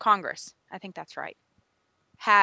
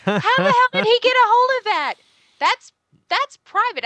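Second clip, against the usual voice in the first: outraged; 14 semitones higher, 7 dB louder than usual. Acted. Outraged at leaked voicemail.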